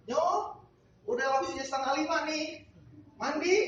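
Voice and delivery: very high, fast mock calling; imitating the maid knocking at the door. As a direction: call the kid to the bath. Maid calls the child to bathe.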